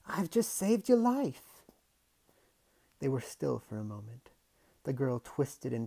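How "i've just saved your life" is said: put-on girl's voice. Girl pleading.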